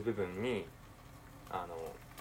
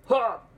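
a sharp, high, loud exhale of relief; loud relieved gasp; 13 semitones higher, 12 dB louder than usual. Real, not acted. After the tense flip, a loud relieved gasp. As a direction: let out the breath he was holding.

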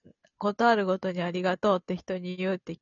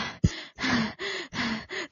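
tired sigh; 4 semitones higher, 7 dB quieter than usual. Real, not acted. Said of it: "疲れた。".

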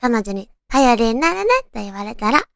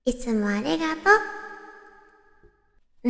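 softer, sincere thank-you; 優しい感謝; 6 dB quieter, faster than usual. Acted. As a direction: say it gently, meaning it. 感謝のセリフを演じる. (しみじみと優しく)

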